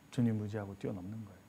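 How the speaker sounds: soft low repeat. Shown as a demonstration. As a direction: murmur the promise back gently. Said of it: "repeated much softer".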